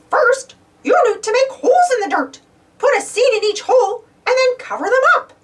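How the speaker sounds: high, helpful Mickey. Acted. Mickey teaching Donald to plant seeds, high and helpful. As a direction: explain it brightly as Mickey. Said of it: "Mickey nodded".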